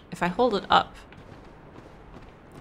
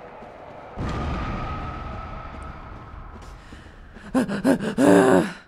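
disbelieving laugh; 3 semitones higher than usual. Real, not acted. Chaos, enemy explodes.

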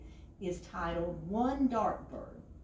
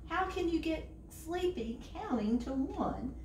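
exasperated; 10 semitones higher than usual. A narrator's reading. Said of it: "shouted Bird".

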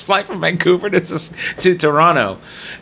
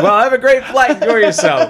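loud, high, over-friendly send-off; co-pilot; 8 semitones higher, 4 dB louder than usual. Retold or imitated. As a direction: imitate a co-pilot putting on cheer. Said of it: "He's like".

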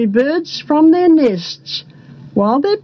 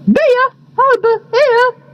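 high-pitched bird-like call; thirsty fellow; 10 semitones higher than usual. A narrator's reading. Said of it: "said a thirsty fellow".

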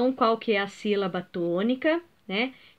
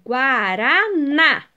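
"Guaraná" slow and loud, syllable by syllable, ending on strong "ná"; stress on last syllable; 5 dB louder, much slower than usual. Shown as a demonstration. Teacher finding pupils another word, stressing final "ná" in slow "Guaraná". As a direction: stretch out "Guaraná" and punch "ná". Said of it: "a última é sílaba tônica".

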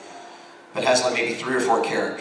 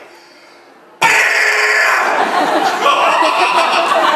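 high, loud shrieking laugh; loud howling laugh; 14 dB louder than usual. Real, not acted. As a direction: burst out laughing at the memory. Wild laugh over gun kick.

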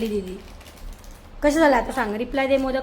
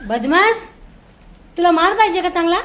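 mock scolding; 4 semitones higher, 8 dB louder than usual. Real, not acted. Mother scolds naughty child.

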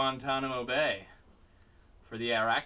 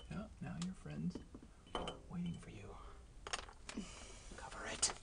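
whisper; 17 dB quieter than usual. Real, not acted. Coaxing the spider out, whispered. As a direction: hush it down to a breath.

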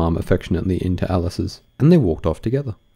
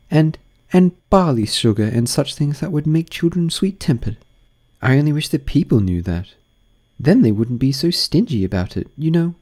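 put-on young girl voice; 8 semitones higher, 3 dB louder than usual. Alice voiced in reading.

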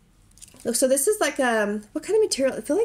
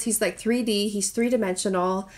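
ordinary talk, nothing marked

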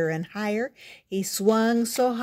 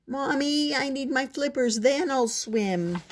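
high, whiny little-frog plea; pleading child; 6 semitones higher than usual. A narrator's reading. Froggy asking mother for his flippers, pleading. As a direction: plead with mommy, drawing out the end.

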